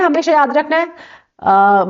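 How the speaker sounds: ordinary delivery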